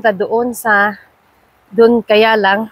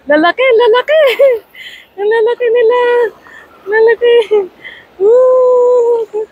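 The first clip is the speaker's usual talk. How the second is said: excited squeals; 11 semitones higher than usual. Real, not acted. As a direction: cheer at the big fish. Big fish seen, thrilled.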